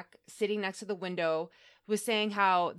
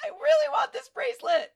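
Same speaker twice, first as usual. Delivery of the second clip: whiny plea; 6 dB louder than usual. Retold or imitated. Wanting to keep the gift, high childish whine. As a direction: whine for the bracelet. Doesn't want to give it up.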